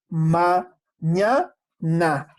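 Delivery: "Ma", "ña", "na" each said apart, showing the mouth; syllables demonstrated. Shown as a demonstration. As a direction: separate "Ma", "ña", "na" clearly. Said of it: "la posición de tu boca". ("Ma", "ña", "na" sílaba por sílaba, marcando la boca)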